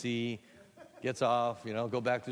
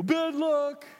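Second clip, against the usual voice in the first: high, loud call; taunting heckler; 9 dB louder than usual. Acted. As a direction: jeer it from the back. Someone shouts a taunt.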